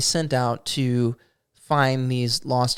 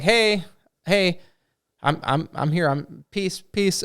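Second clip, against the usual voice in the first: higher-pitched, half-hearted calling out; mock goblin yell; 4 semitones higher than usual. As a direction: imitate a goblin hailing them without really yelling. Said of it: "starts yelling and was like".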